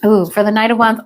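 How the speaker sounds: ordinary talk, nothing marked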